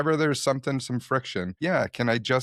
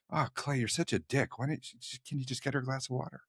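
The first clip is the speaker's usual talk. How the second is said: higher, softer self-scolding; self-reproach; 4 semitones higher, 8 dB quieter than usual. Retold or imitated. Father waking up to his overreaction, softly self-reproachful. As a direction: chide yourself in a small inner voice. Scolding himself in retold inner voice.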